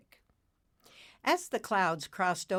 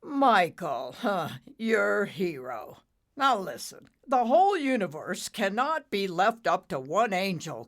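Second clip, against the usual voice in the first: scoffing; 3 semitones higher, 7 dB louder than usual. Acted. Father mocks Nimbus's hero, louder and scornful. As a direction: sneer at the hero.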